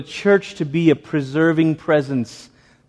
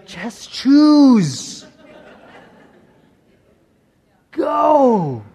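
high, pleading, near tears; urgent plea; 9 semitones higher, much slower than usual. Retold or imitated. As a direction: beg them to just go. Voicing God's command.

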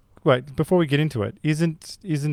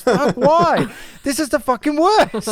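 high, exasperated mock outburst; angry wife; 12 semitones higher, 5 dB louder than usual. Wife complaining to husband about his friend's pick, angry. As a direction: imitate a partner fed up and raging. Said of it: "Katie got angry at you as well".

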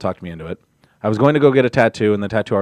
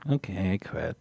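whispered quip; 4 semitones lower, 11 dB quieter than usual. Retold or imitated. Whispered quote.

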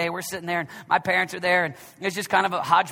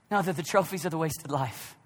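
usual speaking voice

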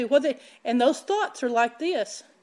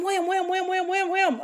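hail banging; 6 semitones higher than usual. Retold or imitated. Hailstones hitting the skylight.

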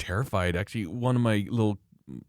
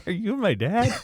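laughing; 12 semitones higher than usual. Retold or imitated.

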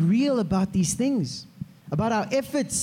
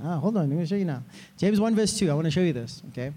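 ordinary talk, nothing marked

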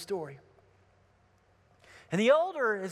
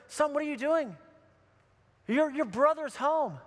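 pleading appeal; 5 semitones higher than usual. Retold or imitated. Father coming out to the older brother, earnest pleading. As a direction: appeal to a stubborn son.

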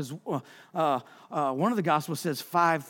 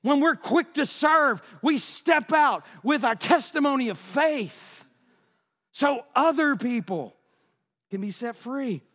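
preaching at full force; 6 semitones higher than usual. Real, not acted. Pastor to congregation, preaching at full force. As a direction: stir the whole church up, full throttle. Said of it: "I'm sorry I'm so stirred up".

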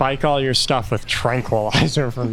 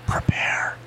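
eerie unvoiced whisper; 4 dB quieter than usual. A narrator's reading. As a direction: breathe it like a ghostly voice. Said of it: "You hear another whisper".

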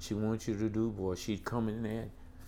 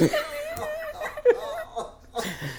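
high laugh; 3 dB louder than usual. Real, not acted. Laughing at memory.

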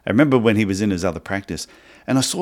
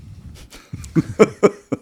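laugh; 13 semitones higher than usual. Real, not acted. Laughing at his own joke.

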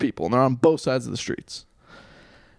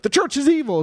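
high-pitched mock outrage; mocking undergrad; 11 semitones higher than usual. Liberal studies undergrads condemning the church, mocking imitation. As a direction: parody a righteous student complaint.

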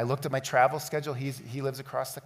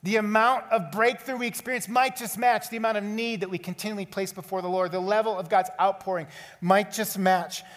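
high, loud, driving sermon voice; rising fervent pulpit call; full-force preaching; 5 semitones higher, 3 dB louder than usual. Acted. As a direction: preach it hard to the whole room. Closing exhortation.

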